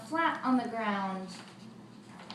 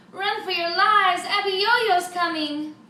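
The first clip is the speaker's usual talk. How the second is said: a high, much louder cry of alarm; panicked shout; 8 semitones higher, 11 dB louder than usual. Acted. Townsfolk warn everyone the giant is coming, shouting. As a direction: yell it to the whole town.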